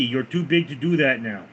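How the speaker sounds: normal talking voice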